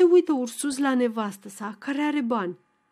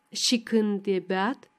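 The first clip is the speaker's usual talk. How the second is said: plain reading voice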